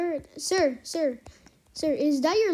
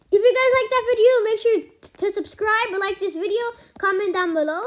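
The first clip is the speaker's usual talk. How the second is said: loud, fast, higher YouTube sign-off; excited outro; 5 semitones higher, 6 dB louder, faster than usual. Real, not acted. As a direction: rattle off the outro with energy.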